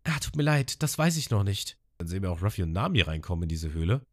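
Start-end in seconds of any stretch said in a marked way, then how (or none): 0.0-1.8 Abyss-Stimme. Retold or imitated. Abyss spricht weiter.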